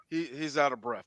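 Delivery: low, quiet deadpan reply impression; Seth MacFarlane. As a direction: drop low and soft to answer as the friend. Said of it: "Hey, Seth, am I out of breath?".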